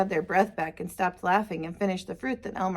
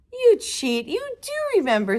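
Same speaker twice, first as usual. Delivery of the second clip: high, louder mock outrage; playful accusation; 10 semitones higher, 4 dB louder than usual. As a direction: catch grandpa out teasingly. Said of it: "said Elmer".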